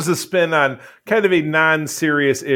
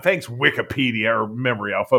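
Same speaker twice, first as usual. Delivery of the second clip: normal talking voice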